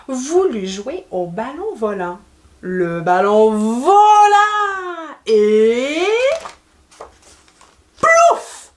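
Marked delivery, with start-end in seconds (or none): 2.6-6.1 very slow, stretched-out narration; suspenseful storytelling. A narrator's reading. Builds suspense before the splash. 8.0-8.7 high, loud imitated plop; splash noise. A narrator's reading. Sound of ball hitting water.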